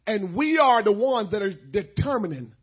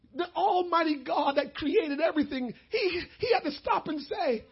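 pleading through real tears; choked up; 10 semitones higher, 3 dB louder than usual. Real, not acted. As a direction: voice breaking with tears, rising high. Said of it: "I get choked up reading this".

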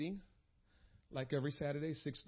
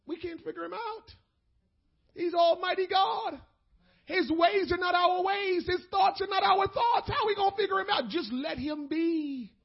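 loud, high preaching build; rising pulpit fervour; full-force preaching; 10 semitones higher, 12 dB louder than usual. Acted. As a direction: preach it out to the church. Preaching to congregation.

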